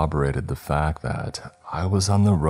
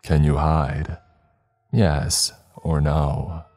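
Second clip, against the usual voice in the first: computer voice; slower than usual. Acted. Game message read by car.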